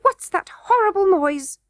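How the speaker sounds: high, shrill-edged goblin queen cry. A narrator's reading. Queen shuddering at Curdie's song, crying out high. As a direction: recoil at the noise. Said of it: "cried the queen".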